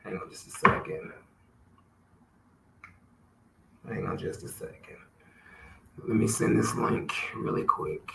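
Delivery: hushed off-mic whisper to self; whispered aside. Real, not acted. Host whispering to himself while sending a link, hushed. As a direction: drop to a breathy aside while busy. Pausing podcast to send link.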